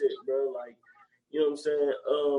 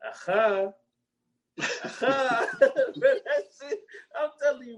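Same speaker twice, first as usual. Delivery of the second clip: real laughter, much higher; laughing; 8 semitones higher, slower than usual. Real, not acted. Laughing at his own joke with a friend, real laughter. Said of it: "laughing at own joke".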